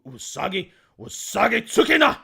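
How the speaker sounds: building from hesitant to a loud shouted samurai cry; samurai movie style. Shown as a demonstration. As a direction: bellow the name like a samurai film hero. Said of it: "pronounce them like it's a samurai movie".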